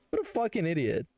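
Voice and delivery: ordinary talk, nothing marked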